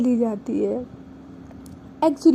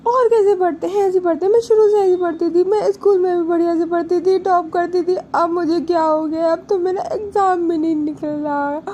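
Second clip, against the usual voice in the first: higher, louder imitated tearful protest; acted crying; 5 semitones higher, 4 dB louder than usual. Upset girl defends her studying to her partner, mock tearful. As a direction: mimic a girl sobbing out her complaint. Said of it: "और वो रोना शुरू कर देती है".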